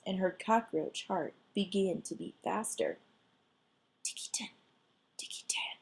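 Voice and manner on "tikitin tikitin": soft unvoiced tikitin; tiny whisper sound. The mouse calls softly from the garden.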